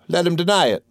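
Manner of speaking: high-pitched, bold retort; defiant challenge. A narrator's reading. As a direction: throw down the challenge, pitched well up. Boy defiantly challenges the accused teacher.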